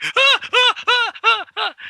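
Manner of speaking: string of very high, loud yelps; repeated falsetto "ah" of mock fright; imitated startled shrieks. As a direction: mimic someone jumping out of his skin. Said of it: "jumped out of his fucking skin and went".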